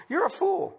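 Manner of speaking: pitched high, punched out; preacher's high rebuke; sharp rebuke. Acted. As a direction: fling the words up high at them.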